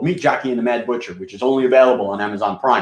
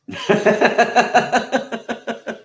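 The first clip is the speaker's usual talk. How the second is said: a real laugh after the rant; high, rolling chuckle; 10 semitones higher than usual. Real, not acted. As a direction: laugh it off, pleased with yourself. After finishing his rant.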